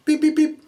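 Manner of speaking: high mock beeping alert; imitated phone beeps. Voter's phone pings with a vote, high beeps. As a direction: chirp it like a phone notification.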